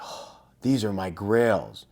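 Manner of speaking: slow, breath-filled awe; awed. Retold or imitated. Harris sees the shoes he has longed for, awed. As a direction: sigh it out like beholding treasure.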